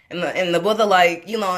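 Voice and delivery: ordinary talk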